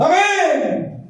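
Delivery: very high, drawn-out amen; overexcited high-pitched response; eager congregation. Acted. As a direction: mimic a crowd cheering the promise. Congregation eagerly answering prophecy.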